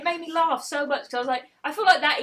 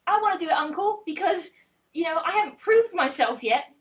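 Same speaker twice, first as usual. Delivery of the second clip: put-on higher-pitched, pleading young Gawain; eager little nephew; 5 semitones higher than usual. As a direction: play a small nephew begging his uncle. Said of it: "little Gawain, Arthur's little nephew".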